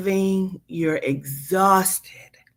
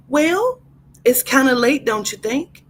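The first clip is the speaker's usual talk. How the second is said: high, prim clerk asking sweetly; prissy clerk voice; 6 semitones higher, 4 dB louder than usual. Acted. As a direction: play the nosy clerk, pitched high.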